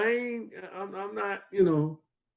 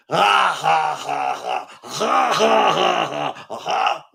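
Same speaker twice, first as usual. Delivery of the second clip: hysterical Joker laugh; much slower than usual. Retold or imitated. Mocking the preacher laughing at the pulpit, hysterical Joker cackle. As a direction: imitate a maniacal villain's laugh. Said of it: "hysterical or something, like he the Joker".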